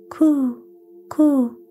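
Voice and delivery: high, sing-song pigeon coos; cooing pigeon. Acted. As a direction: coo like a wood pigeon. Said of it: "Then the wood pigeon said".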